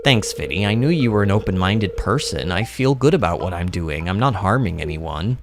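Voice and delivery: low, sincere game character voice; a hint of a low, grateful outcast; lightly voiced homeless man. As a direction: pitch it down, mildly earnest.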